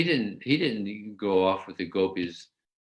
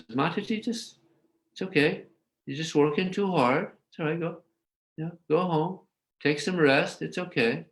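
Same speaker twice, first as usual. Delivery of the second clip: higher, gentle reassurance; calm her down kindly, voice lifted higher; reassuring mother; 8 semitones higher than usual. Retold or imitated. Mother Yasoda sending the confused gopi home, gently reassuring.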